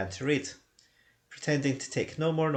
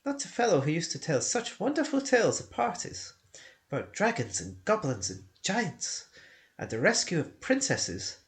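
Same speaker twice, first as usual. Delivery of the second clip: astonished hobbit; 6 semitones higher than usual. Acted. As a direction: gasp out the name as it comes back. Bilbo suddenly remembering.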